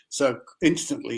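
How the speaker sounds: ordinary delivery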